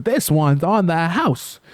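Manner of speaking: high, slow, pointed line; triumphant SpongeBob. Retold or imitated. Vlogger voicing SpongeBob slamming the pizza in the customer's face, high slow. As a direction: deliver it slowly and high, savoring the payback.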